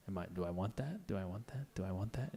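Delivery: muttering to oneself. Retold or imitated. Shopper muttering to self.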